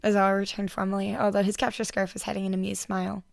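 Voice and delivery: ordinary delivery, nothing marked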